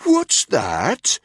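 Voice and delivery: high, loud mock-deaf bark; exaggeratedly loud. A narrator's reading. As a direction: boom it out, pretending not to hear. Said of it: "in an exaggeratedly loud voice".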